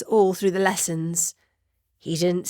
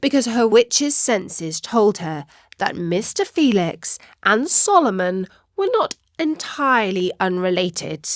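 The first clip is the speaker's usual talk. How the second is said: ordinary delivery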